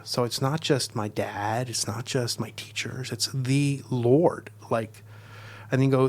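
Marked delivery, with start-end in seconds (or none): 3.1-4.5 "the" as THEE and "Lord", both very slow; stress on the Lord. Shown as a demonstration. Teacher contrasting God with dad, weighty stress on "the Lord". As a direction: spell out who it is, drawing out "the Lord".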